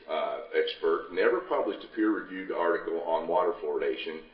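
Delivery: ordinary talk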